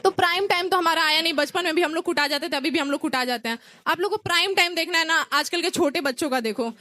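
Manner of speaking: ordinary talk, nothing marked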